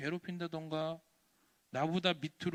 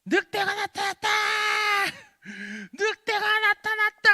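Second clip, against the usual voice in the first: very high, loud cry of alarm; shouting alarm; 14 semitones higher, 10 dB louder than usual. Acted. Bored boy crying wolf to the villagers, shouting alarm. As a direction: shriek the warning across the whole village. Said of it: "하고 소리를 지르는 거야".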